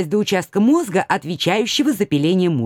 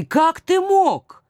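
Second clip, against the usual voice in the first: betrayed wail; 9 semitones higher, much slower than usual. Acted. Betrayed wife's anguished question.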